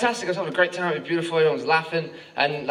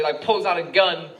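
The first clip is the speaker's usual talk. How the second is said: normal talking voice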